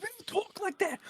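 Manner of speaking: New York lady scolding him, mock high voice. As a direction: imitate the scolding lady from the TikTok. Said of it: "she's like".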